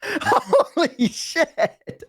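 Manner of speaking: high, slow, laughing disbelief; cracking up mid-sentence; breathless, wheezing laughter. Real, not acted. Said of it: "laughing, far higher".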